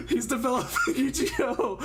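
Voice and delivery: ordinary talk, nothing marked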